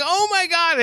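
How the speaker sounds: high, startled oh my God; flustered cry. Retold or imitated. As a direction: squeak it out, mortified. Flustered self quote at vet.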